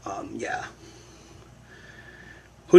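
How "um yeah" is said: breathy whisper under the breath; whispered aside. Real, not acted. Host trailing off into a whisper. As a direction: drop to a whisper.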